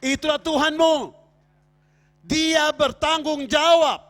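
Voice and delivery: seruan khotbah lantang dan tinggi; khotbah berapi-api. Real, not acted. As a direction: serukan tiap frasa kepada jemaat dengan tenaga penuh. Berkhotbah dengan tenaga penuh. (berkhotbah lantang berapi-api)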